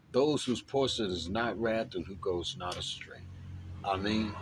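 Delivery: soft, devout recitation of a prayer. Real, not acted.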